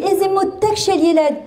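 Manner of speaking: high, slow cooing praise; gushing school teacher. Retold or imitated. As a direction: imitate a teacher doting on a child. School staff gush over the child.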